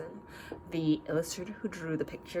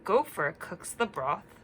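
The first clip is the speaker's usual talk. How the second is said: put-on reading to children; 4 dB louder, slower than usual. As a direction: read it gently to little listeners. Story reading.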